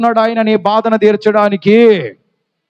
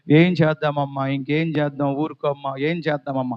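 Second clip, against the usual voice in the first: half-hearted consoling; 6 semitones lower, 7 dB quieter than usual. Retold or imitated. Bystanders giving shallow comfort.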